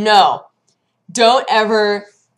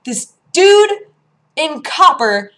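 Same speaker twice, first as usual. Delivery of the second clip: indignant outburst; 8 semitones higher, 7 dB louder, slower than usual. Retold or imitated. Outraged retelling.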